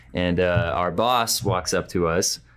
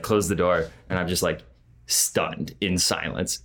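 normal talking voice

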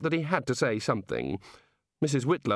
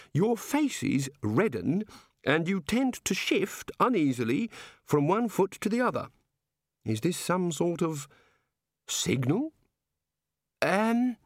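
high, slow, inquisitive god voice; innocent, measured wondering; curious, genial god; 7 semitones higher than usual. Acted. God asking wizards how it all works, innocently curious.

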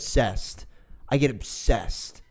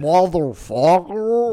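high, loud, slow Motherfucker; mock drawn-out curse; 6 semitones higher, 8 dB louder, slower than usual. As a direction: stretch it out in a silly high voice. Mocking the woman's voice again.

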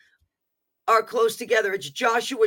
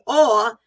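"awe" punched and set apart; stress on awe; 3 semitones higher, 8 dB louder than usual. Acted. Proclaiming God's power, "awe" stressed. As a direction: land with weight on "awe". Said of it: "that's capitalized".

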